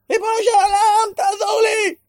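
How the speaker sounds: a high, loud cry for help; desperate cry. Shown as a demonstration. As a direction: shout it up to God, desperate. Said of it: "מה זה נקרא". (צעקה נואשת וחזקה כלפי שמיים)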